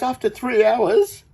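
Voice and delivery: high, loud, slow retelling of the guy; mock high quote. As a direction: squeak it out in a mock voice. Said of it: "He goes".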